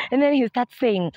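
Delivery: ordinary delivery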